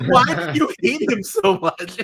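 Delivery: high-pitched, breaking into laughter; can barely get the words out through real laughter; laughing hard. Real, not acted. Retelling a brutal movie gag to co-hosts, laughing hard.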